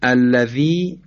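الذی with a drawn-out final ee; long i. Shown as a demonstration. Teacher showing the long vowel, final ee of الذی stretched. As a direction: hold the ee at the end of الذی. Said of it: "صدای کشیدهٔ ای داره".

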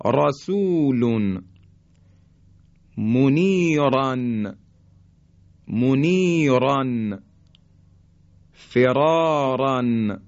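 slow recitation, thick R in رسول، منیران، فراران، رقود، خبیر، غرورا; heavy ra. Shown as a demonstration. Teacher giving tafkhim examples, heavy ra from رسول to غرورا. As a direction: draw out each word, ra full and heavy as in غرورا. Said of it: "این تفخیم حرف را هست".

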